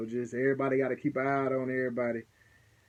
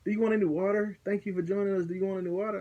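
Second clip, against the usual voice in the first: higher, eager mock host voice; mock-sweet host; 6 semitones higher, much faster than usual. Partner offering water to the possessed man, mock-sweet and high. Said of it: "I look at him".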